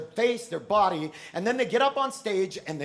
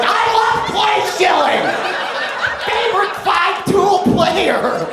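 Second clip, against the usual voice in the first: much higher, loud fan screaming; hyped crowd; 9 semitones higher, 8 dB louder than usual. Acted. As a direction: scream like a drugged-up audience. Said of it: "the studio audience is like".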